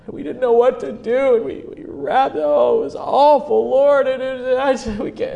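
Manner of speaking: high, loud, distraught retelling; weeping disciples. Acted. Disciples pouring out the prison horror to Jesus, acted weeping. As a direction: wail it out as overwhelmed, grief-stricken men. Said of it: "weeping over that moment".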